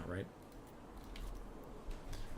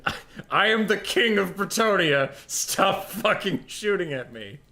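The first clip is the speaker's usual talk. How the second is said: mock king; 8 semitones higher, 5 dB louder, slower than usual. Voicing the Bretonnian king.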